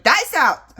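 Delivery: loud barked call, like a commanding officer. Retold or imitated. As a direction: call it out like an officer giving an order. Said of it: "I would say in a loud voice".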